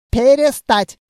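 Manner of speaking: high, loud officer's order; indignant command. A narrator's reading. Officer orders the tipping to stop, outraged.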